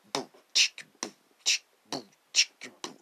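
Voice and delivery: vocal drum beat, boo and chick; beatbox a simple rock beat. Shown as a demonstration. Showing the radio host a harmless rock beat, beatboxed. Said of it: "I'm gonna give you a beat".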